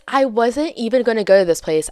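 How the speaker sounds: usual speaking voice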